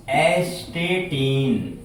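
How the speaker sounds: very slow, drawn-out "एस्टेटीन"; slow dictation. Shown as a demonstration. Teacher dictates an element name to pupils, slowly drawn out. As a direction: stretch each syllable of "एस्टेटीन" as if dictating.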